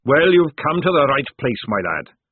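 loud, booming fat sergeant; hearty sergeant. Acted. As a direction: boom it like a jovial recruiter.

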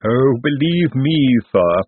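slow, knowing Igor; a hint of an avuncular chuckle. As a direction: chuckle it like a wise uncle. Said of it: "avuncular little chuckle".

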